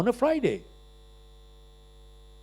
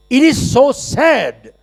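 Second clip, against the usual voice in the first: higher, louder and slow; emphatic declaration; 7 semitones higher, 7 dB louder, slower than usual. Acted. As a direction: proclaim it to the whole hall. Emphatic sad remark, repeated. (ऊँची, ज़ोरदार, धीमी घोषणा)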